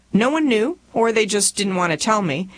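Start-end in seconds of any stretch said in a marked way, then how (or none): none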